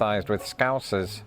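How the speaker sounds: usual speaking voice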